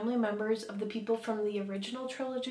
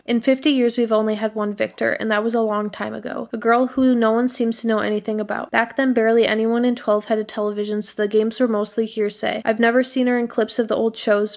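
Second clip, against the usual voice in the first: louder, steady dramatic reading; reading a heartbroken narrator's lines aloud; 12 dB louder than usual. Acted. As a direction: read the grief passage out to viewers. Reads a book passage aloud.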